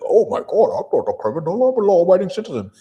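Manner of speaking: high, loud, rushed mock protest; mock protesting citizen. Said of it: "You'd be like".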